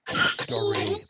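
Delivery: "History" with "His" whispered, the rest voiced; whispered first syllable. Shown as a demonstration. Coach shows a bigger word, "His" whispered in "History". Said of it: "I'm gonna whisper the first syllable".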